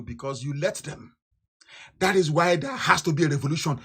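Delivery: usual speaking voice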